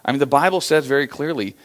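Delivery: usual speaking voice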